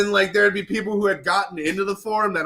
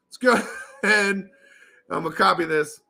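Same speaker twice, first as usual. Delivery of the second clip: usual speaking voice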